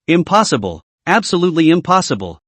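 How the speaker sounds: plain reading voice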